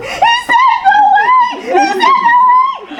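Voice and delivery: very high, drawn-out screaming for joy; re-enacted overjoyed scream; ecstatic yelling. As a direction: shriek the amazing news to the family. Retold ecstatic yelling to husband.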